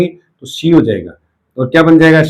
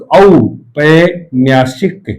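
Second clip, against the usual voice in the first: औपन्यासिक drawn out syllable by syllable; slow syllable demo; much slower than usual. Shown as a demonstration. Teacher slowly sounds out the new word for students. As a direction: spell out औपन्यासिक very slowly for the class. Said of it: "इक जोड़ेंगे तो औपन्यासिक".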